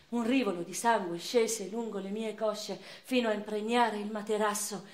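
ordinary talk, nothing marked

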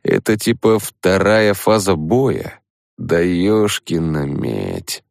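usual speaking voice

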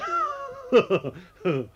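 kurzes, sehr hohes Auflachen; hohes Lachen. Acted. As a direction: lacht hoch und spöttisch auf. Eisenring lacht Biedermann aus. (hohes spöttisches Lachen)

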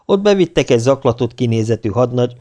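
usual speaking voice

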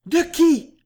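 high, loud, breathless-style gasp; gasping question. A narrator's reading. Juliette asks the general whom she must charm, gasping. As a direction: gasp it out, pitch leaping up. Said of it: "haleta Juliette".

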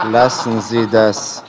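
slow, loud, cutting order; stern command. Acted. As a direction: shut it down, slowly and hard. Officer cuts off the laughing. (langsam und laut befohlen)

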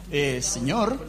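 timid desperate disciple. Acted.

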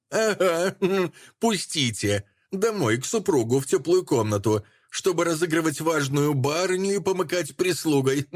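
high, laughing scornful mimicry; mocking laugh. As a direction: laugh and mock her plea. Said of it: "торжествующей презрительной улыбкой".

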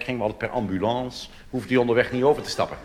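ordinary delivery

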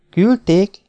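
sharp high disbelieving echo; startled question. Acted. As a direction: catch the word in alarm. Girl startled by the message. (döbbent visszakérdezés)

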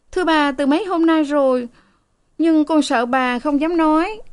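put-on high, timid young maid. A narrator's reading. Frightened maid confesses to her mistress, high and timid.

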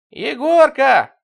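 very loud, high, long-drawn call; stretched high holler; calling down the phone. A narrator's reading. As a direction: sing out the name shrilly into a receiver. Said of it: "послышалось в трубке".

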